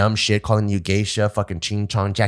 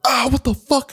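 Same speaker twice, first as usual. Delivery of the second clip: pained high yelp; 14 semitones higher than usual. Retold or imitated. Recruit's head slammed on bar.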